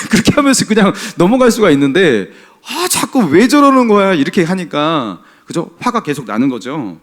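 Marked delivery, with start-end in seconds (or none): 2.7-4.1 raised, louder exasperated complaint. Acted. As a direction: complain in frustration, pitched well up. 남을 못마땅해하는 속마음 연기. (답답해서 짜증 내는 말투)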